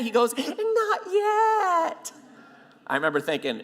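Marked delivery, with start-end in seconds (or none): 0.4-2.1 very high falsetto, last word stretched; comic high-pitched reply, held long; mock giddy squeal. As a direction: imitate a gleeful squeaky confession. Officer's giddy reply, retold.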